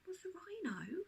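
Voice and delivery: put-on high, timid child whisper. A narrator's reading. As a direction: whisper it, caught out. Daisy answering accused father.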